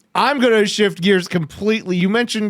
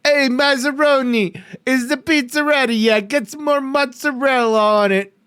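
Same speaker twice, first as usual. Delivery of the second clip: high-pitched mock Italian pizzeria accent; 7 semitones higher than usual. Host teasing about a pizza order, mock Italian accent. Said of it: "that's definitely more Italian".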